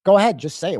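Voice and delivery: a loud retold dare; daring retort. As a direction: dare her to say it. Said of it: "I'm like".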